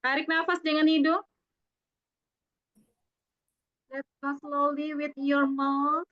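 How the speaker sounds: a gentle breathing-exercise leader; guided breathing. Acted. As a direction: guide the group through each breath.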